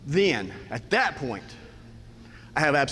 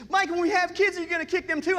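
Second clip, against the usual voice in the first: very high-pitched upset wife voice; imitating tearful wife; 13 semitones higher than usual. Wife runs out in tears to confront husband, wailing. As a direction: wail it high, mimicking a distraught wife. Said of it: "runs from the back of the house in tears".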